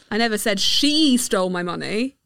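"she" stressed, rest plain. Shown as a demonstration. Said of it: "depending on the stressed word".

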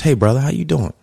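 high, put-on friendly brother greeting; lightly imitating a coworker trying to sound cool; a hint of forced buddy-buddy tone; mock code switch. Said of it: "But when they get to you now, it's".

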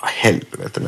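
breathy under-the-breath swear; a half-whispered curse in the car; muttered curse. Retold or imitated. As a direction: swear to yourself, barely voiced. Fuel light on, police chasing.

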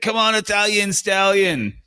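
mock shout of encouragement; imitated yelling. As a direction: holler it out like heckling a mate. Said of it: "he's yelling, yelling out to his mates".

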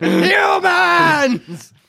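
high furious shout; ogre roar. Acted. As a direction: roar it, spitting mad. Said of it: "Spittle flies out at you".

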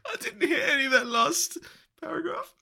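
high voice through laughter; laughing. Real, not acted. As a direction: still cracking up. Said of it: "I was laughing too hard".

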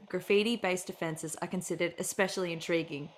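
solemn, hushed announcer, falling at the ends; mock crime-show intro. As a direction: read it like a deadly serious police-show voice-over.

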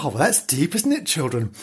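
usual speaking voice

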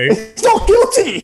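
a drawn-out mock falsetto sneer; mock high singsong. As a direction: sing the verdict back in a mock falsetto. Mimicking the verdict, mocking.

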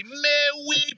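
slow, high, lightly put-on British accent; a bad British accent stretched out slowly; mock British accent. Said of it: "try to use my British accent".

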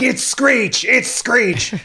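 high insistent yell; yelling back as security drags him off; mock shout.

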